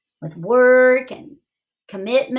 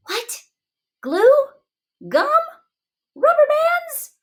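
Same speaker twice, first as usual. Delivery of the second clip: each word pitched way up in disbelief; incredulous; 3 dB louder than usual. Acted. Teacher reacting to glue and gum, incredulous. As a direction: pile up the outrage, word by word. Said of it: "incredulous listing of repair items".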